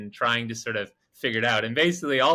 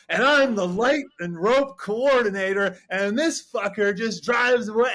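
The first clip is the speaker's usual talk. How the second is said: high, loud mock indignant rant; imitated ranting; 8 semitones higher, 4 dB louder than usual. Patty complaining in the kitchen about being left, mock ranting. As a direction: go off like an aggrieved drunk, playing it for fun. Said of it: "he just starts going off about".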